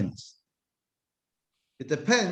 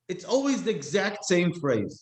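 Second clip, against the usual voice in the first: ordinary talk, nothing marked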